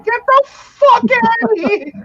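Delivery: can't believe what he just heard; incredulous shout. Real, not acted. Disbelief at friend's claim.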